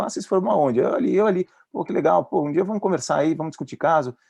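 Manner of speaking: high, delighted colleague voice; eager doctor. Retold or imitated. Psychiatrist happily greeting the caller, high and eager. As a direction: gush like a doctor glad to get a call. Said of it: "Alô, doutor, tudo bem?".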